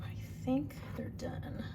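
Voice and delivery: slow, hushed whisper. Real, not acted. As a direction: whisper it slowly to yourself. Judging scones, whispered take.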